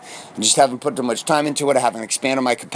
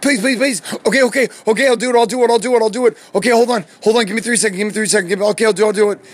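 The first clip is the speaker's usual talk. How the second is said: high-pitched, panicky pleading; acted-out desperate plea for more time; frantic begging; 6 semitones higher, faster than usual. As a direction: beg in a rapid, repetitive tumble. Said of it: "I'm, like, begging".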